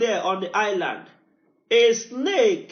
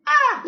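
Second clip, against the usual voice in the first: a sudden high cry of fervor; high exclamation; 4 dB louder than usual. Real, not acted. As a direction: shout it out high and sharp. Preacher cries out in fervor.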